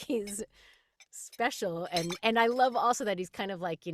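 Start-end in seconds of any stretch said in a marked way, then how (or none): none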